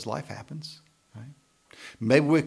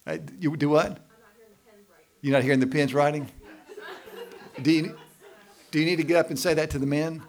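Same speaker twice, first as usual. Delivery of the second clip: teasing; 4 semitones higher, 6 dB louder than usual. Real, not acted. Teasing women taking notes.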